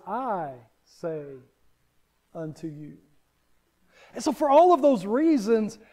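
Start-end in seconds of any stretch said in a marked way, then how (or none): 0.1-3.0 "I say unto you" dropped low and very soft; hushed Jesus quote. Retold or imitated. Preacher voicing Jesus to the congregation: hushed and solemn. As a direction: let the words fall to a hushed, weighty hush. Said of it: "and he says".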